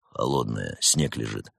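low, terse customer voice; unimpressed low-voiced operative; dour agent. A narrator's reading. Terse agent answering excitable technician.

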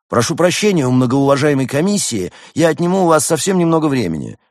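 high, loud, polite address; Roman, eager young hedgehog. A narrator's reading. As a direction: address the panel in a ringing young voice.

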